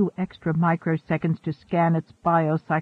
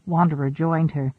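usual speaking voice

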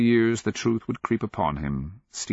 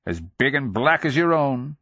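higher, goading jibe; baiting; 7 semitones higher, 3 dB louder than usual. A narrator's reading. Trying to bait his towering friend, goading. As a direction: needle your friend with a raised pitch. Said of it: "obviously trying to bait his towering friend".

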